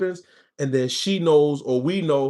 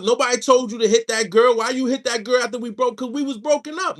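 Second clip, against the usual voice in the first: put-on higher-pitched, raised, accusing girlfriend; 6 semitones higher than usual. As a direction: throw the blame back at him, a little higher. Girlfriend's side of quarrel.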